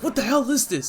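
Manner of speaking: high-pitched disbelief; acted shocked bystander; astonished outcry. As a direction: blurt it out high in bafflement. Pro heroes react to force field.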